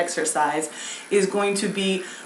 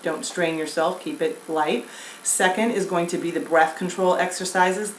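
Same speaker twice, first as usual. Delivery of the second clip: ordinary talk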